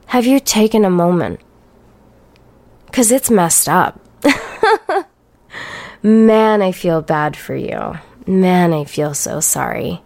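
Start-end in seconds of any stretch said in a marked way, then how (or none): 4.2-5.0 short high laugh. Real, not acted. Laughing at the trolls.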